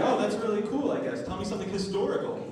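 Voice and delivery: lightly mock a bored nurse. Comic imitating the blood bank nurse, mock bored. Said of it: "She's like".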